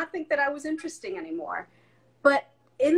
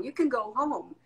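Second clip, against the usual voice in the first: ordinary talk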